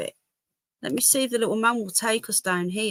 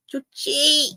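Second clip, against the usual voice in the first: calling the dog; 6 semitones higher, 3 dB louder than usual. Real, not acted.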